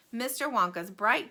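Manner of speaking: ordinary delivery, nothing marked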